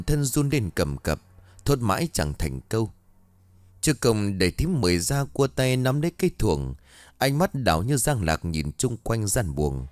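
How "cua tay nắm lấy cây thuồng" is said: ordinary delivery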